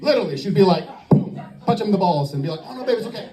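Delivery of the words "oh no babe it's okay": soft, higher-pitched cooing reassurance; mock soothing. Woman comforting the man she just hit, mock soothing. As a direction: coo it softly, imitating a comforting girlfriend.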